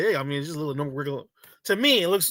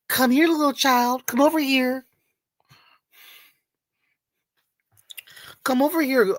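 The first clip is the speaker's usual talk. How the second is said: a lightly raised mock luring voice; creepy puppet voice; 6 semitones higher than usual. Streamer retelling the puppet show, lightly raised creepy voice. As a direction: tease the puppet's call, pitched up a little. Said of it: "having puppet shows with little children".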